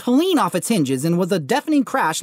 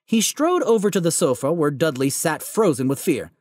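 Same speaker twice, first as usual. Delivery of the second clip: ordinary delivery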